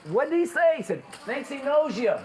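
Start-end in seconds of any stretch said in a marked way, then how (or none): 0.1-0.8 put-on loud, very high old lady voice; hard-of-hearing old lady. Old wife butts in on cop's story, loud high voice. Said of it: "The old lady don't hear real good". 1.2-2.1 high-pitched punchline delivery; old husband repeating. Acted. Old husband relays cop's remark to wife, high raised voice.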